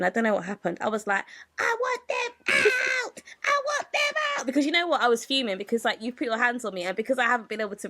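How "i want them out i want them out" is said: high, demanding Karen shriek. Retold or imitated. Narrator demanding security remove the girl, high Karen voice. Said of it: "I turned Karen".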